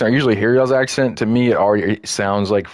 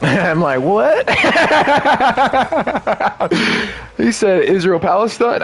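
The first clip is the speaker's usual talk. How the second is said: high-pitched, incredulous laughing talk; cracking up; 11 semitones higher than usual. Real, not acted. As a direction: talk through a big laughing fit. Cracking up at what was said.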